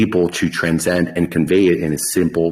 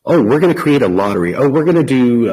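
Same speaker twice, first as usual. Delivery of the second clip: higher, louder mock-excited pitch; mocking imitation; 7 semitones higher, 4 dB louder, faster than usual. Host mimics rival crypto teams pitching lotteries, mocking imitation. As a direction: parrot rival projects in a raised, mocking voice.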